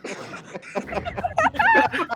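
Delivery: high burst of laughing; laughter. Real, not acted. Reader laughing hard at the broken clock joke. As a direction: crack up.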